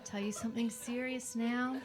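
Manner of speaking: ordinary talk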